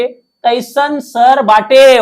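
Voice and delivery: slow, drawn-out mnemonic line in Bhojpuri style; dialogue voice. Shown as a demonstration. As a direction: stretch each word like a dialogue. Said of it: "Leena के dialogue कैसा बोलना है". (धीरे-धीरे खींचकर बोला गया dialogue)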